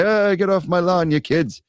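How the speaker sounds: put-on a light Irish old-man grumble, high; grumpy Irish codger shooing kids, lightly voiced; Irish accent. Old Irish man yelling at neighbourhood kids, mock grumpy. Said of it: "I don't know why I did an Irish accent there".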